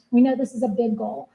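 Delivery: ordinary talk, nothing marked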